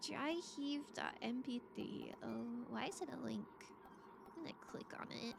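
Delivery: ordinary talk, nothing marked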